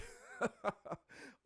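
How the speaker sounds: high laugh trailing down; real high, falling laugh; helpless laugh. Real, not acted. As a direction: crack up at the remark. Host laughing at co-host's remark.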